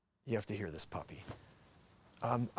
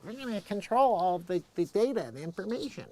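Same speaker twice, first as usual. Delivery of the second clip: raised, mock officious voice; mocking the officials; 6 semitones higher, 3 dB louder than usual. Mocking the ban supporters in a put-on voice. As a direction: lampoon the people who think the ban helps.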